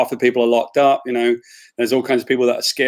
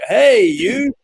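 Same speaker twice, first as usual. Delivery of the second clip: high, put-on hello to someone he can't place; mock breezy greeting hiding he forgot the name; awkward reply; 8 semitones higher, 4 dB louder than usual. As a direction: bluff a greeting to a stranger. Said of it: "I'd be like".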